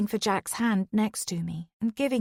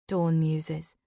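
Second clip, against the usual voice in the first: usual speaking voice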